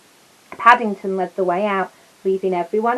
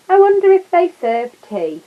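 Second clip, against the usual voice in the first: high, loud hopeful lady; eager lady tourist; 9 semitones higher, 8 dB louder than usual. Acted. Tourist lady hoping for tea at the house, high eager.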